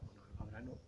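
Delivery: "algo habrá" repeated in a soft near-whisper; mocking whispered echo. Shown as a demonstration. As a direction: echo the excuse under your breath, mocking it. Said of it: "Pero siempre algo habrá". (eco susurrado y burlón de "algo habrá")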